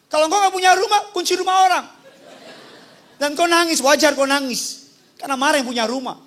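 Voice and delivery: ordinary delivery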